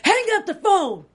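high-pitched, loud growled command; Batman. Retold or imitated. Acting out Briggs storming in, loud mock Batman. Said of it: "doing the recap for Batman".

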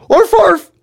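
very high, loud small-dog yaps; Lobzik barking. A narrator's reading. Family dog wants to help too, high barking. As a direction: add two sharp little barks. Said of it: "Lobzik added".

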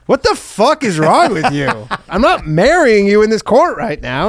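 mock appalled refusal. Woman turning down a courtroom proposal, mock outraged.

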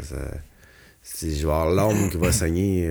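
ordinary talk

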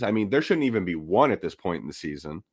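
ordinary delivery

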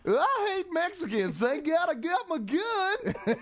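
mock Southern accent. Asked to do a Southern accent, a hammed-up hillbilly drawl. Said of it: "do a Southern accent".

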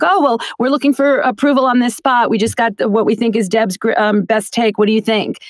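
high, hammed-up producer voice; mock the producer pitching to the client; teasing producer asking for approval. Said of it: "as a joke for themselves".

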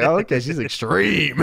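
amused, laughing through it; laughing mock reaction. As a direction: chuckle out the words. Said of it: "I was like".

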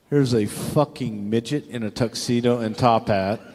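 ordinary talk, nothing marked

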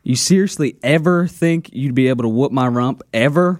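"ever" enunciated with exaggerated care; mock-quoting with weight on "ever"; heavily enunciated EVER. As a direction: lean hard on each "ever". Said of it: "you enunciated ever so much".

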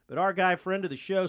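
ordinary talk

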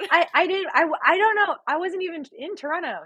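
high, stammering mock innocence; feigned denial. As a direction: deny everything in a high-pitched fluster. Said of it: "I'm gonna just pretend that didn't".

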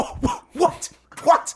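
high breathless disbelief; surprised. Real, not acted. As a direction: gasp out a shocked what. Shocked at LeBron remark.

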